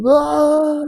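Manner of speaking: high, loud cartoonish scream; imitated scream. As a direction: yelp out a mock scream as if blown through the air. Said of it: "you'll scream".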